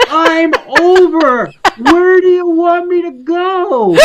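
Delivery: high, slow, laughing exasperation; exasperated. Retold or imitated. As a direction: plead with mock despair. Exasperated reply to cyclist.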